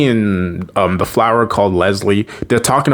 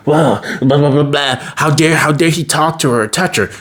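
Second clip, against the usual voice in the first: higher-pitched, mock-indignant raving to oneself; crazy self-talk; 5 semitones higher than usual. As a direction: imitate someone ranting jealously to himself. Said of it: "talking to himself like a schizo crazy person".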